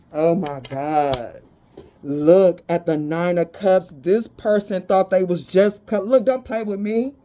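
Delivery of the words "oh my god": ordinary talk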